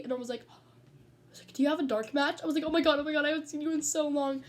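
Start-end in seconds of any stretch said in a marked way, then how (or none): 1.5-4.4 louder, higher mock gushing; acted excitement. Fan re-enacting her reaction to a wrestler's post, excited gushing. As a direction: gush like a thrilled fan.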